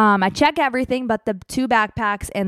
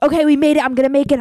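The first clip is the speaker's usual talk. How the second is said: louder, triumphant self-pep; relieved and excited; 4 semitones higher, 6 dB louder, much faster than usual. Retold or imitated. Rushing traveler pumps herself up after ditching bags, excited. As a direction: cheer yourself on mid-sprint.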